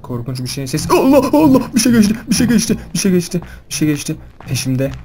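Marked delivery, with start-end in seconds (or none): 0.9-4.1 panicked scream. Real, not acted. Monster passes in horror game.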